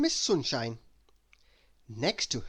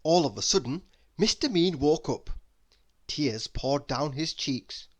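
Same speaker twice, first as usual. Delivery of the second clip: usual speaking voice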